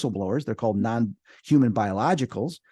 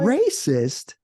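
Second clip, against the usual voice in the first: gnome voice; 4 semitones higher than usual. Retold or imitated. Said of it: "that kind of a voice".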